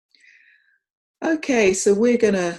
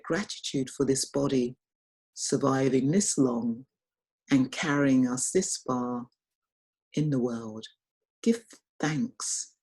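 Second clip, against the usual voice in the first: guided meditation. Real, not acted. Teacher guiding online group into gratitude for the body, guided meditation. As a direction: lead them gently inward, phrase by phrase.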